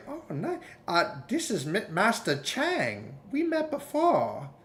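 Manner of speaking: high, hammy martial arts master voice; karate master. Retold or imitated. Comedian mimics a karate master greeting him.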